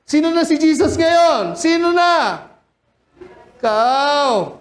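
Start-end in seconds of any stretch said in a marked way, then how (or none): 0.1-2.5 mocking challenge. Preacher taunting, acted challenge.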